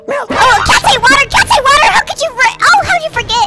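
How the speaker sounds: high, loud panicked outburst; alarmed shouting. Pretend. Dad realizing the cat can't take water, alarmed shouting. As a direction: cry out in sudden alarm.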